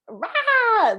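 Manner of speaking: high, loud mock tantrum shriek; mock toddler tantrum roar. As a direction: let out a child's furious wail. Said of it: "The tantrum's happening".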